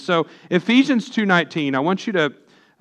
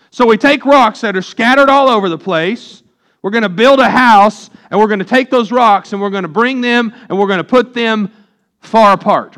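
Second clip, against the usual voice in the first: higher, louder preacher drive building; fervent preaching; 5 semitones higher, 5 dB louder than usual. Acted. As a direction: push the picture home to the congregation. Preaches building-stones image, rising force.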